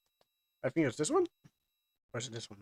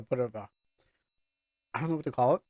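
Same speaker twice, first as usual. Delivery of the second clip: ordinary talk, nothing marked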